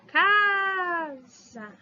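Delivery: "Casa" high and drawn, "Ca" stressed. Shown as a demonstration. Teacher modelling tonic syllable for class. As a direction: stretch "Casa" on first syllable.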